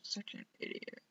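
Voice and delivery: whispered self-scolding; self-deprecating whisper. Real, not acted. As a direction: whisper it at yourself, annoyed.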